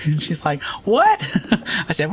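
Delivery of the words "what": high squeaky what, laughing; baffled clerk. Retold or imitated. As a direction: caught off guard, laughing. Confused clerk on prank call.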